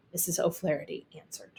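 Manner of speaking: usual speaking voice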